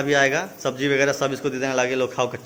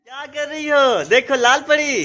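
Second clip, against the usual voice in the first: playful calling out; 12 semitones higher, 6 dB louder than usual. Real, not acted. Dad calling his little child, playful high call.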